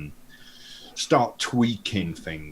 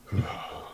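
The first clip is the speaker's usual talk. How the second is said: a long unvoiced sigh of resignation; weary sigh. Pilot's antics draw Dave's weary sigh. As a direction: breathe out a tired sigh. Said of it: "and he goes".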